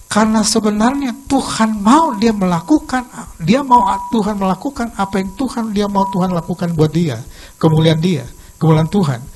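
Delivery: loud, driving sermon delivery; forceful preaching. Acted. Preacher drives the lesson of Jonah home to the congregation, loud and forceful. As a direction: press the point home to the congregation.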